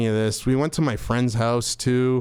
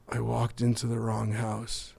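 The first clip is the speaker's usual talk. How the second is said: very soft, stunned delivery; shocked confession; 8 dB quieter than usual. Retold or imitated. Pale friend back at the party confessing, hushed and stunned. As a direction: imitate someone drained by shock, barely voicing it.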